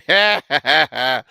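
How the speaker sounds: loud burst of laughter; real, loud belly laugh. Real, not acted. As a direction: crack up mid-reading. Laughs mid-reading of proverb.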